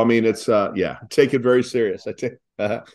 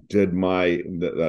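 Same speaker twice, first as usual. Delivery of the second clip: ordinary delivery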